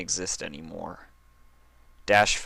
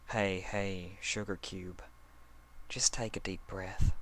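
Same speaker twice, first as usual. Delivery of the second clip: soothing murmur; 8 dB quieter than usual. Acted. Applejack soothing trapped Dash.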